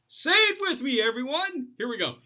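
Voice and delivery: high, lively call to join in; rallying the room. Real, not acted. Coach inviting the audience to repeat, rising and eager. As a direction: invite everyone in with a lift. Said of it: "leads the audience".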